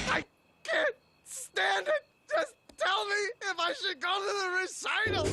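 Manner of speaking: high, tearful shouting; desperate wail. Acted. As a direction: beg for an answer at breaking point. Breaking down, begging for an answer.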